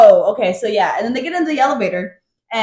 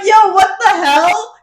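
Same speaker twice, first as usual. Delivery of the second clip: baffled Bakshin; 9 semitones higher, slower than usual. Retold or imitated. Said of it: "Bakshin's like".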